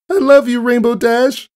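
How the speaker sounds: high, loud sobbing outburst; tearful cry. Acted. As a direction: cry it out with all your heart. Sobbing filly clings to hero.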